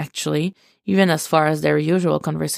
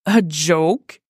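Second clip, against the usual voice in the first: incredulous. Acted. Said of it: "Con repeated incredulously".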